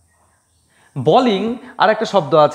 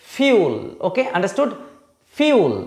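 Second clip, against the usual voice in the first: normal talking voice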